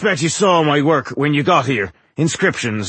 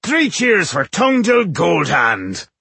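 slow, high, drawn-out toast; rally the drinkers to a cheer; rousing cheer; 7 semitones higher, 4 dB louder, slower than usual. A narrator's reading. Mason leads drinking companions in a toast, rousing.

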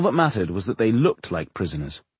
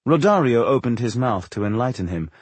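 ordinary delivery

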